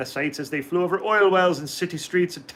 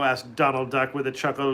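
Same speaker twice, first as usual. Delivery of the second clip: usual speaking voice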